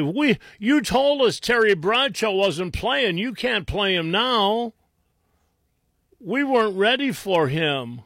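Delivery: high-pitched mock complaint; mock whining. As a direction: whine on behalf of the unprepared opponents. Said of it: "sit around whining and say".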